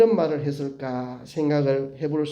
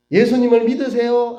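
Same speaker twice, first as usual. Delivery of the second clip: put-on high, loud question to children; rally the little ones with it; acted teacher calling to a class; Sunday school teacher; 6 semitones higher, 5 dB louder than usual. Acts teacher quizzing small children.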